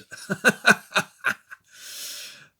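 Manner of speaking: loud, high breathy cackle; big laugh. Real, not acted. Man cracking up at his own Thatcher joke, loud laugh. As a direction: burst out laughing, long and loud.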